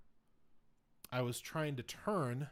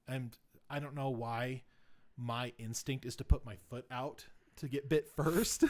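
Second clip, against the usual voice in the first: ordinary delivery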